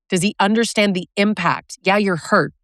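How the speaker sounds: normal talking voice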